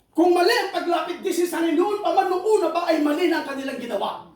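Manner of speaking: high, loud preacher's build; rally the congregation to pray; preacher shouting each trial in turn; fiery preaching. Acted. Preaching at full force.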